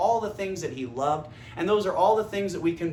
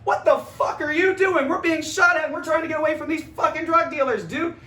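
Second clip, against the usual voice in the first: panicked yelling; 9 semitones higher, 6 dB louder than usual. Retold or imitated. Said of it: "and they're like".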